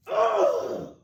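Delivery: high exasperated groan; frustrated ugh. A narrator's reading. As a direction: let out a fed-up ugh.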